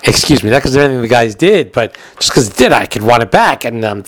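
ordinary talk, nothing marked

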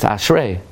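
"ashrei" stressed on its last syllable; "ashrei" as ash-REI; correct stress. Shown as a demonstration. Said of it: "the emphasis is always on the last syllable".

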